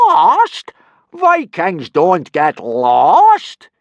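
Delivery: very high, loud, slow scoffing; mock the very idea; scornful boom. Teacher booming scorn at the boys.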